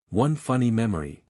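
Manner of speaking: plain reading voice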